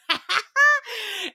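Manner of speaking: a loud, high laugh bursting out; high burst of laughter. Real, not acted. Laughing at the motorboating teaser, high and loud. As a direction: crack up at the memory.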